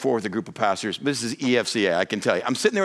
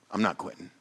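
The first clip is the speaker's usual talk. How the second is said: quiet determined repeat; softer resolve; 6 semitones lower, 7 dB quieter than usual. Real, not acted. Pastor repeats to congregation, soft and resolute. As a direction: say it again, softer and firm. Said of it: "But I'm not quitting".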